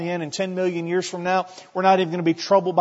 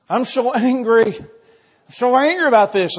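higher, louder, slow pretend anger; mock angry; 7 semitones higher, 7 dB louder than usual. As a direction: act out a believer fuming at God. Preacher voices angry believer.

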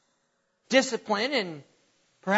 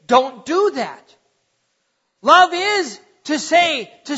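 emphatic preaching; 3 semitones higher, 5 dB louder than usual. Real, not acted. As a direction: drive every phrase home to the congregation. Preacher urging loving correction.

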